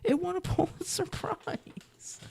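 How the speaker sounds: mock exaggerated repeat. Shown as a demonstration. Repeating his own point to the list, high and mocking. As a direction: squeak it back through a laugh. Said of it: "You say it right there".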